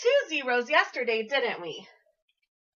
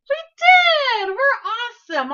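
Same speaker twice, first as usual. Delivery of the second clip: much higher, loud triumphant cheer; excited cheer; 12 semitones higher, 9 dB louder, slower than usual. Real, not acted. Celebrating the correct count.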